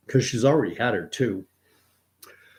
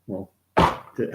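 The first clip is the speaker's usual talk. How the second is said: ordinary talk, nothing marked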